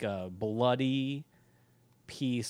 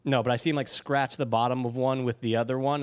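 ordinary talk

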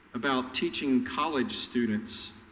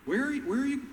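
high rising lost question; bewildered disciples; 11 semitones higher than usual. Retold or imitated. Disciples failing to follow Jesus, high and bewildered. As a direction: sound totally lost. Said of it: "they're like".

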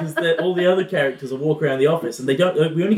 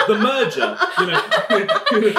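ordinary talk

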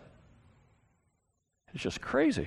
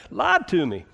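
put-on repeated in Southern accent; short Southern drawl; 4 semitones higher than usual. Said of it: "You gotta say it in a southern accent".

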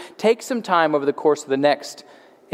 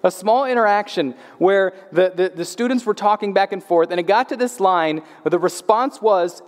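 usual speaking voice

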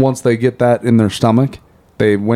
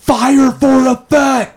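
high, slow commanding radio call; 13 semitones higher, much slower than usual. Acted. Radio call exchange acted.

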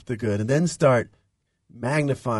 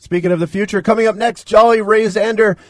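bright, louder, higher announcer voice; radio announcer; 8 semitones higher, 7 dB louder than usual. Acted. Host announcing to listeners, upbeat announcer voice. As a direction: hype the next segment like a radio host.

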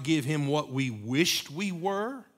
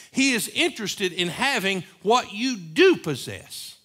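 louder, higher building sermon cadence; rising preaching; 5 semitones higher, 6 dB louder than usual. Acted. Preacher assuring the congregation God chose them, louder. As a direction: drive it home to the church with fervor.

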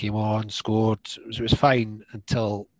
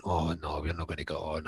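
muttered inner thought; 5 semitones lower, 10 dB quieter than usual. Retold or imitated. Inner thought told in a story.

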